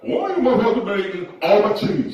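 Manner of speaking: put-on high, loud drunken rant; drunk complainer. Drunk man accusing the whole room over cheese, loud and high. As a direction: holler an outraged tipsy complaint. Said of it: "That's what he sounds like".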